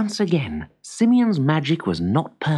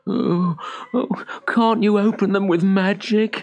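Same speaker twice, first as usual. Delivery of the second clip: higher, worried appeal; anxious plea; 6 semitones higher than usual. A narrator's reading. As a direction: plead for a way out. Tired fugitive pleading at locked doors.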